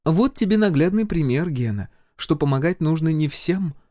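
high-pitched healer making a point; healer, lecturing. A narrator's reading. Healer instructs Gena behind his back, pointedly. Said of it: "раздался за спиной голос целительницы".

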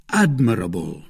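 usual speaking voice

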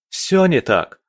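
высокое, горячее возражение; возрази с жаром; горячо. A narrator's reading. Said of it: "горячо ответил Малик". (горячо, на повышенных тонах)

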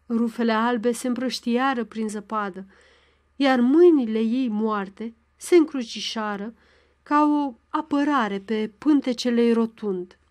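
plain reading voice

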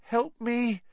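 high, moaning plea for help; breathless fright. Acted. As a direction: moan it in panic. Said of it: "he moaned to Hermione".

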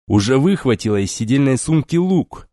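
ordinary delivery

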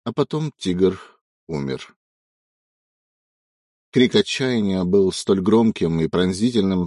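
usual speaking voice

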